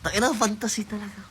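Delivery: high, loud thrilled outburst; excited squeal. Real, not acted. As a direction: squeal it with delight. Excited aside about the fantasy.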